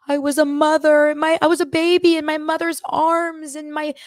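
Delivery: imitating a weeping castmate in a breathless rush; hysterical crying quote. Retelling Dorinda crying after water therapy, high mock sob. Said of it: "She was hysterical".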